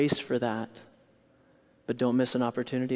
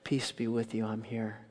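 much softer, calm and tender; gentle Jesus; 3 semitones lower, 7 dB quieter than usual. Acted. Jesus beside the listener in the dark, tender hush. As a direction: comfort the lonely listener. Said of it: "and says".

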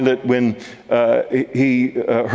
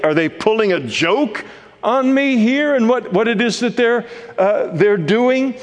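higher, incredulous Pilate questioning a beaten prisoner; amazed disbelief; 8 semitones higher than usual. Acted. Pilate staring at battered Jesus, asking in amazed disbelief. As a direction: sound stunned that this is the accused king. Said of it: "He's amazed when he looks at Jesus".

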